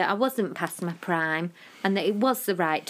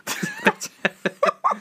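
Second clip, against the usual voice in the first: laughing; 4 dB louder, slower than usual. Shown as a demonstration. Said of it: "But I mean the sound".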